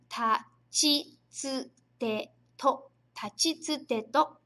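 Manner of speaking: tachitsuteto, each syllable drawn out slowly; slow syllable reading. Shown as a demonstration. Teacher leading class to read tachitsuteto slowly. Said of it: "É o".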